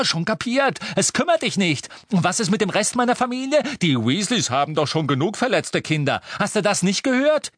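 high, loud, heated reproach; angry outburst. A narrator's reading. Ron accusing Harry of not caring, heated outburst. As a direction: cut him off and lay into him.

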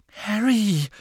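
high, scandalised exclamation; shocked. A narrator's reading. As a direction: gasp the name in shock. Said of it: "klang schockiert".

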